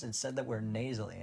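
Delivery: ordinary talk, nothing marked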